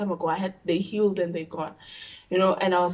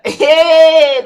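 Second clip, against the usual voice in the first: loud laughing cry; laughing shout; 8 semitones higher, 17 dB louder than usual. Real, not acted. Telling viewers the funny part, laughing loudly. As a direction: burst out laughing.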